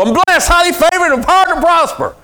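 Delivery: high, loud triumphant declaration; rising joyful boast; bold proclamation. Shown as a demonstration. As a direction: shout it out like a winner. Bold answer he wants.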